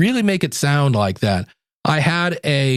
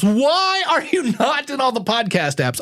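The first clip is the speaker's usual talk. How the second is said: exasperated; 8 semitones higher than usual. Acted. Mock exasperation at listeners.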